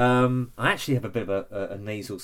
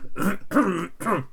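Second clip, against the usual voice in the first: harsh choking cough; coughing fit; 9 semitones higher, 4 dB louder than usual. Real, not acted. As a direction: cough hard mid-welcome. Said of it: "now I'm choking to death".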